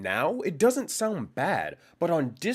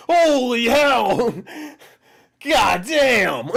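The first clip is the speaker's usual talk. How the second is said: loud laughing exclamations; laughing shout; 6 semitones higher, 9 dB louder, much slower than usual. Real, not acted. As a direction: shout it while cracking up.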